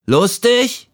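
high, incredulous little voice; questioning leaf. A narrator's reading. Doubtful leaf questions its friends, high-pitched query. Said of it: "fragte es".

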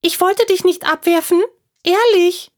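high squeaky pleading squirrel voice; small animal voice, anxious; frightened squirrel. Acted. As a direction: plead like a scared little squirrel.

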